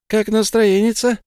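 high playful fairy voice; chirpy little fairy; pixie. A narrator's reading. Fairy blonde speaks.

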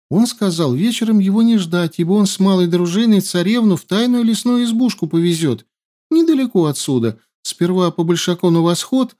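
high, pleading rush of detail; eager informant. A narrator's reading. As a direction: spill everything to be spared. Captive eagerly betrays info. (угодливая скороговорка)

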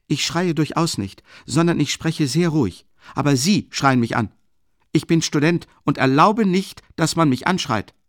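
loud, high protest while claiming calm; student loudly protesting he is spoken to rudely; indignant shouting. A narrator's reading. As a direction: shout that you are not shouting.

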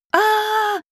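high, held cry at moderate volume; restrained narrated scream, pitched high; high sustained scream. A narrator's reading. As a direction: draw out a high "aah" without full force. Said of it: "закричала женщина".

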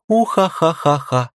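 high villainous tickle-threat laugh; being tickled. Acted. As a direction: cackle with playful menace. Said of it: "Я буду щекотать тебя".

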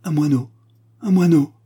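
high, loud echo of the cry; mocking exclamation. A narrator's reading. Guests making fun of the Spaniard's cry, loud exclamation. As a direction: call it out in jovial disbelief. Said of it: "en riant, s'écrièrent".

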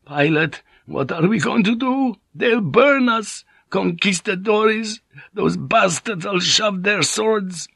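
very high, frightened wail; trembling voice. A narrator's reading. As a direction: panic drawn out slowly in a high, trembling voice. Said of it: "voice began to tremble".

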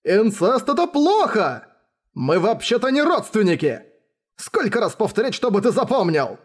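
high, fed-up protest; exasperated cry. Acted. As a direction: cry out at someone who never listens. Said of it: "крикнул я".

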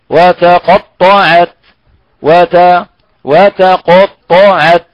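وتقطعت stretched very slowly, letter by letter; slow recitation drill. Shown as a demonstration. Teacher drilling a Quranic word slowly.

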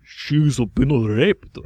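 very slow repeat in a put-on Japanese accent. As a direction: stretch every word in a Japanese accent. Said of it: "going to my Japanese accent".

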